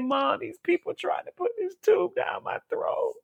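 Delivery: high, breathless tearful near-whisper; choked up. Real, not acted. Daughter telling mama about the tube, choked up. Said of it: "I'm sorry to get emotional".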